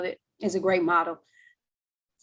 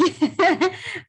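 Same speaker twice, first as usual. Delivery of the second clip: short laugh; 11 semitones higher than usual. Real, not acted. Laughs after teasing.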